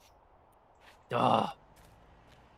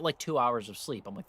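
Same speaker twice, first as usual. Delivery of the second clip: ordinary delivery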